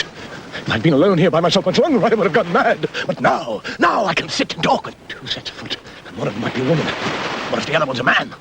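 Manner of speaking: lightly played castaway talking to himself; marooned loner working himself up, pitch climbing on threats; excited castaway. Castaway alone spotting footprints, excitedly acted.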